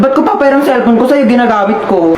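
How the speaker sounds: loud refusing big sister; angry sibling. Acted. Older sibling refuses to lend her phone, loud and angry. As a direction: snap at the little sibling, keep the phone.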